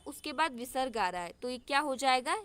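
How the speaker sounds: normal talking voice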